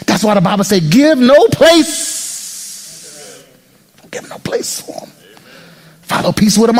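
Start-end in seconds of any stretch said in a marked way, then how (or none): 0.9-3.4 very slow, stretched Give no place; drawn-out scripture. Acted. 4.0-5.1 whispered echo. Acted.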